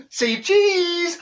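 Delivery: high, loud say cheese; bright camera call; photographer's call. Retold or imitated. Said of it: "here's Neville again".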